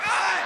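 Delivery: cry of pain. Acted. Groaning at remembered pain.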